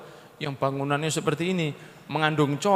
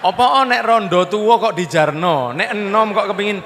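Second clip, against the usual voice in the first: mocking complaint; 8 dB louder than usual.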